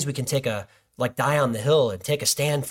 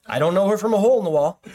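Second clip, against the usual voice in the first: higher, louder, fast disbelief; incredulous outburst; 7 semitones higher, 5 dB louder, faster than usual. Real, not acted. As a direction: blurt it out in amazement. Telling a stranger's oversharing.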